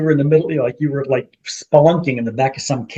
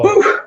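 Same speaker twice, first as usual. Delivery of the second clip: relieved whew; 3 dB louder than usual. Real, not acted. Relief after scary story.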